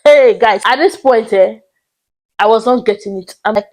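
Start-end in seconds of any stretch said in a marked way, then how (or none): none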